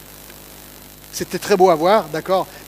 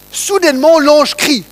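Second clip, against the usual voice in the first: raised, emphatic voice for a startling moment; sudden dramatic outburst; 5 semitones higher, 7 dB louder than usual. Acted. Preacher dramatises the angel's sudden cry to listeners, loud outburst. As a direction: burst in loud and high.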